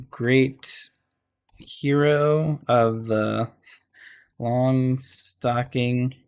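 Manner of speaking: very slow, drawn-out words with long pauses; composing while writing. Real, not acted. Game master wording the Longstocking lore, slow composing aloud. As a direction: sound out each word slowly while writing.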